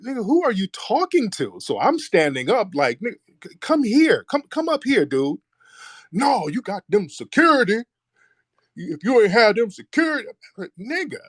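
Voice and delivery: high, challenging shout-back; heated comeback yell. Retold or imitated. Teller yells back at the heckler who pointed at him, high and heated. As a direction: call him out, come up here.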